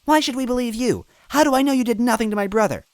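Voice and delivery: high, fast demanding voice; Toadette, defiant. A narrator's reading. Toadette confronting the villain, high fast defiant voice. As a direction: push back at her.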